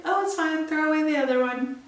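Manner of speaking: a hint of an offhand husband brushing it off; husband's breezy reply. Garrett shrugging off the broken glass, lightly voiced.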